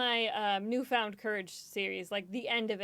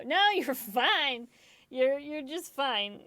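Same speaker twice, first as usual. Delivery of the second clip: high, loud, drawn-out reassurance; acted reassurance; 5 semitones higher, 6 dB louder, much slower than usual. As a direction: insist on it slowly, pitched up. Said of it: "I'll go".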